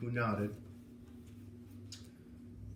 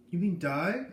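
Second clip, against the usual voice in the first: high, loud frightened question; shocked fear; 7 semitones higher, 8 dB louder, slower than usual. A narrator's reading. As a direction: blurt it out in alarm. Boy hears teacher may die.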